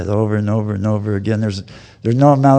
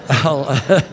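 higher voice, breaking into laughter; amused, struggling to get words out; laughing; 5 semitones higher than usual. Real, not acted. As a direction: talk through a real laugh. Cracks up over untold story.

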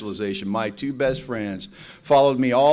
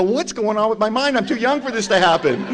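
sister's mock panic; 8 semitones higher, 5 dB louder than usual. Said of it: "I go".

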